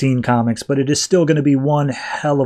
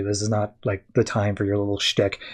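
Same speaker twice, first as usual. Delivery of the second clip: much softer, lower, a weary telling-off; quiet scolding; 5 semitones lower, 8 dB quieter than usual. Retold or imitated. Deathstroke tells Harley off, soft and low. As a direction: drop it low and tired, as if scolding a child.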